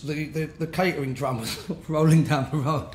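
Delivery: ordinary delivery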